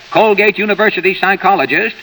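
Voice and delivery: usual speaking voice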